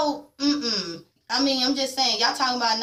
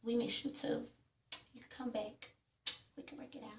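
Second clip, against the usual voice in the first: soft hushed retelling of her answer; hushed mock reply; 15 dB quieter than usual. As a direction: murmur her lines as if half to herself. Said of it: "She's like".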